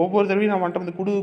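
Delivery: ordinary talk, nothing marked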